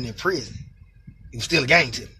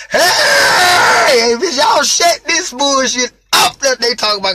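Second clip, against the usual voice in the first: higher, louder holler down the vent; yelling at the inmates to hush; loud call; 8 semitones higher, 6 dB louder than usual. Retold or imitated. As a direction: shout over their chatter. Calling through the vent.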